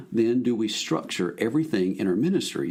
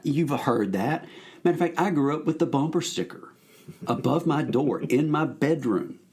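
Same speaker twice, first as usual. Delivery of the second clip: usual speaking voice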